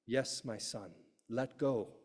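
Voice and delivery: low, quiet voice of God; voice from heaven. Acted. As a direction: answer softly and low, like God from above. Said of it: "there's a voice from heaven".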